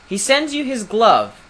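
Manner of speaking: high, loud proclamation; calling out. A narrator's reading. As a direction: call down a formal challenge. Prince calls challenge from above.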